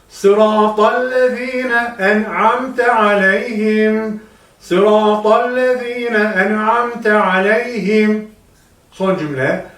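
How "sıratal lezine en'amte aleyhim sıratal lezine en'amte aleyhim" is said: lezine with a lisped th sound, sıratal thin, slow. Shown as a demonstration. Teacher points out the lisped letter to students. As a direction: say lezine with the tongue between teeth, th not z. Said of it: "burada peltek diye geldi".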